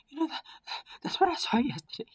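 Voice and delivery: very high, put-on character voice; high-pitched, halting reply; shaken character voice. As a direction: squeak it out, stumbling over the start. Shaken character answers after attack.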